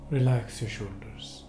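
usual speaking voice

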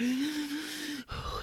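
long, high gasp of pain, re-enacted. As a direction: act out sucking in air through pain. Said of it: "I'm just like".

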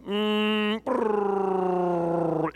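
humming brrr of an engine dying; engine sputtering out. Retold or imitated. To the listener, imitating the bus engine dying with a humming brrr. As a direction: mimic the bus motor shutting down.

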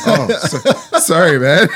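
high, loud retold apology through laughter; laughing mock apology. Stranger apologizing to the wrong guy, laughing mock apology. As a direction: act out his sorry while cracking up.

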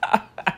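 short voiceless chuckle; amused laugh. Real, not acted. Podcaster laughing at his horrible British accent, breathy chuckle. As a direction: chuckle at your own bad impression.

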